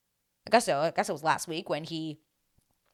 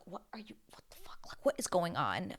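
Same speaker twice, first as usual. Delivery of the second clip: imitating hushed, baffled onlookers; scandalised whisper among bystanders; shocked whispering; 9 dB quieter than usual. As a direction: whisper it fast in disbelief. Other women's hushed reaction acted out.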